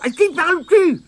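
high, piercing suspicious question; grumbling granny. A narrator's reading. Mémé Croche questioning Tirondin, grumbling shrilly. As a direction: grumble it high, as a sharp old woman. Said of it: "grommela Mémé Croche".

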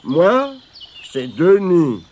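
loud-edged, put-on mournful voice; glum stranger. A narrator's reading. Stranger giving Tirondin his name, mournfully. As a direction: introduce yourself as the sad stranger. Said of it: "marmonna l'inconnu".

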